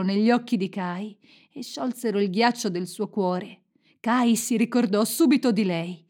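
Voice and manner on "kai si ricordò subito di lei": usual speaking voice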